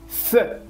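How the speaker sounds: "Z" as a lisped th sound alone; Castilian Z. Shown as a demonstration. Teacher showing the sound a Castilian would make, th-like Z. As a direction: make "Z" the th of English "think". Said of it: "que haría un castellano".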